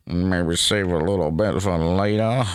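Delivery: low, sinister creepy voice. Pretend. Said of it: "apologies for the creepy voice".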